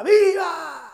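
loud, high shout of Viva; crowd shout. Acted. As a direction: cheer like a crowd on Independence night. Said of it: "todo el mundo grita".

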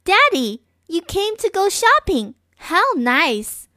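put-on a happy girl landing on dad's car; cheerful little girl. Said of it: "Ganin looked at him and said".

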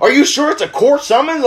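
high, worried dad impression; imitating an anxious father seeking a way out; nervous and scared. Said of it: "so fucking nervous and scared".

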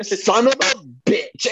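high, slow, mock-furious woman's voice; mock outraged woman. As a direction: swing into a shrill-free high outburst, drawn out. Retelling woman's sudden outburst.

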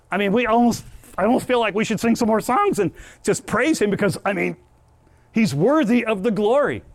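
preacher swelling into praise; rising praise. Real, not acted. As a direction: lift it up with joy.

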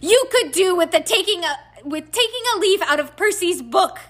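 high, loud angry scolding; yelling. A narrator's reading. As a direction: yell it at a misbehaving son. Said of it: "yelled Missus Weasley".

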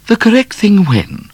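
high, sharp question; high puzzled Miss Laura; puzzled lady. A narrator's reading. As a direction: demand an answer. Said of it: "said Miss Laura".

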